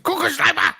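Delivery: high-pitched mock-aggressive German; hammed-up aggressive outburst. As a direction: attack the word like an angry German. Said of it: "it just sounds aggressive".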